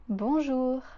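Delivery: teacher's model bonjour; French bonjour. Shown as a demonstration. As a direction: clear model bonjour for repeating. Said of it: "try to repeat after me".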